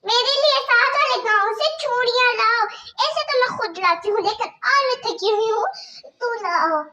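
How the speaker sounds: harsh stepmother scolding. Acted. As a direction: bark orders at the girl like a cruel stepmother. Stepmother orders bangles.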